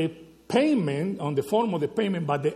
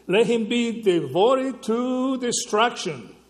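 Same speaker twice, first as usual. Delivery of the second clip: slow weighty proclamation; 6 semitones higher, 4 dB louder, slower than usual. Acted. Preacher reads curse slowly.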